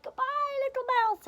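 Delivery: very high, loud wailed farewell; fleeing fox. Acted. Fox flees from the Gruffalo, high wailed farewell. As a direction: wail goodbye in mock panic.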